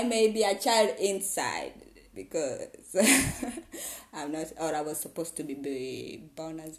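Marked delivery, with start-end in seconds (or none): 2.7-4.1 short burst of laughter; a high bright laugh at herself. Real, not acted. Laughs at own joke.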